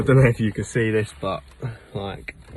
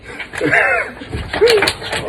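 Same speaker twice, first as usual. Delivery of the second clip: loud laugh; 6 dB louder than usual. Real, not acted. Bathtub won't fit in car.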